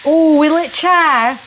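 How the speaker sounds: high, loud and slow, hopeful and anxious; will it char, fretting out loud; eager worry. Real, not acted. Watching the lettuce in the pan, high eager fretting.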